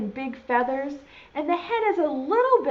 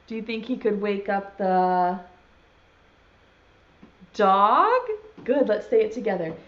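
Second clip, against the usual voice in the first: normal talking voice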